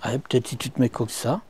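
ordinary delivery

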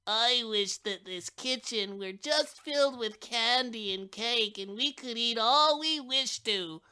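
lightly voiced child making a wish; eager child. A narrator's reading. Child making a wish on the wishbone, lightly eager. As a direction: wish aloud dreamily. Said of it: "one of the children said".

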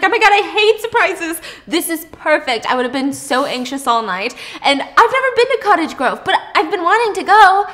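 excited gushing. Retold or imitated. Friend gushing to her date about the trip, excited. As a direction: squeal it out like the best surprise ever. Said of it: "She's so excited. She's freaking out".

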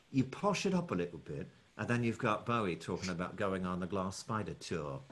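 slightly posh Cockney, softly spoken; smooth the London accent out and posh it up. Shown as a demonstration. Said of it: "a slightly posh Cockney".

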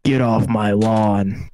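slow, lightly voiced cranky old man; grumpy old man voice. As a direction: drag it out like a cranky grandpa.

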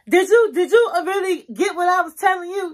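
high, loud, disrespectful challenge; sarcastic attitude. Shown as a demonstration. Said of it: "You don't wanna talk like that".

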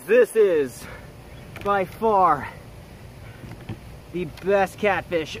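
triumphant, can't believe the catch; elated whoop. Real, not acted. Lands the big fish, whoops.

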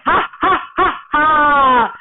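high, spelled-out mocking ha-ha; hammed-up storybook laugh at the fooled villagers. A narrator's reading. Feku laughs at the fooled villagers, acted laughing. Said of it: "Feku was just laughing".

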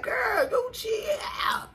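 high slow shouted reply; mock shout back. Mom yelling back at her kids, high and slow. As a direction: yell back at the kid, hammed up. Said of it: "I be like".

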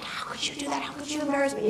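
imitated wife's furious whisper; hushed, mortified telling-off; scolding whisper. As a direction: whisper it reproachfully, so others won't hear. Wife scolding husband under her breath.

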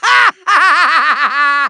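pulsing villain cackle; triumphant evil laugh. Acted. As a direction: laugh as if unable to contain it. Villain overjoyed with himself.

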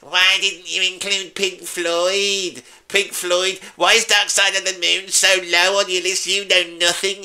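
high, slow whiny moan. Pretend. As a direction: whine like a sulky commenter. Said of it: "read it out in that whiny voice".

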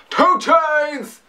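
[name] impression. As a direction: bark his name like the rapper's hype tag. Repeats rapper ad-lib.

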